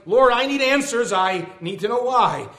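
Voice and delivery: loud, high-pitched protest in verse; indignant plea. Acted. Believer crying out to God for answers, indignant pleading. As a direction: plead indignantly, easing toward weariness at the end. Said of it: "My indignant reply".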